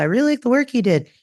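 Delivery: high, fast cheerful praise; a boss happily praising; mock boss on the phone.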